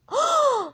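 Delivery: high, voiced offended gasp; sharp high intake of hurt surprise; shocked gasp. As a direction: gasp loudly in mock shock. Valentino reacts to the insult.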